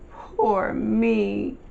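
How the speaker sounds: high drawn-out wail of self-pity; woeful little "poor me" cry. A narrator's reading. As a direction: wail it pitifully, stretching each word. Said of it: "he wails".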